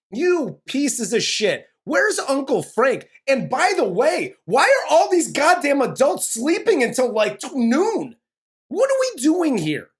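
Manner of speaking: loud, high, outraged rant; exasperated rant. Real, not acted. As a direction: vent your outrage at them. Ranting at parents.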